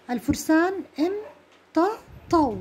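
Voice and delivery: الفرسان امتطوا read slowly, each syllable drawn out; dictating the conjugation slowly; slow dictation. Shown as a demonstration. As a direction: spell it out slowly for the class. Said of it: "لأنه أصل امتطى".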